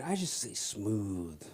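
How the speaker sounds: slow, low and soft; smooth. Shown as a demonstration. Comic claiming cocaine calms him, slow smooth murmur. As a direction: ease it out, unhurried and mellow.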